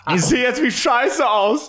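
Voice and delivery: high, laughing German voice; hammed-up German rant through laughter; mock German.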